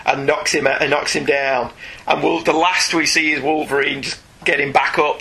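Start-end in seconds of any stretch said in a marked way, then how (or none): none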